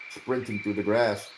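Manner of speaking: usual speaking voice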